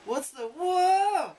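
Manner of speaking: high, drawn-out cry; dragon yelling. Acted. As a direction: yell it out, startled. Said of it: "The dragon yells".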